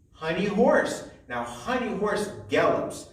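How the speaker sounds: ordinary talk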